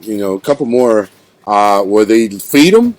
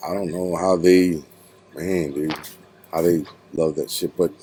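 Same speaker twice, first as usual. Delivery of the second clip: ordinary talk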